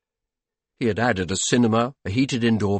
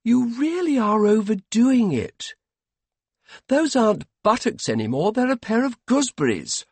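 high-pitched wifely reproach; scolding wife; 12 semitones higher, 4 dB louder than usual. A narrator's reading. Wife telling master he is overdoing it, high scolding. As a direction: voice a wife chiding her husband.

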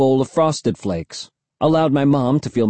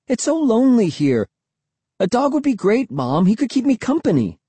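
put-on high-pitched kid pleading with mom; high, wheedling appeal; insisting; 11 semitones higher than usual. A narrator's reading. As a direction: plead your case, voice pitched up. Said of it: "I insisted".

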